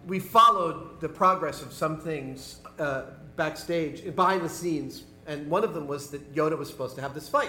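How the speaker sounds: ordinary talk, nothing marked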